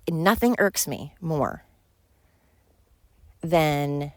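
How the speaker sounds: normal talking voice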